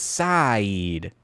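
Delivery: "Saeed" stretched out very slowly through its vowels; exaggerated pronunciation. Shown as a demonstration. Streamer mocking a hard name, "Saeed" very drawn out. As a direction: drag out every vowel of "Saeed". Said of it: "three vowels in there".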